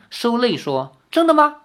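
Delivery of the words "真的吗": put-on high, eager child asking; a little boy lighting up with hope; bright wide-eyed child voice; hopeful surprise. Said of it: "那孩童张大了眼".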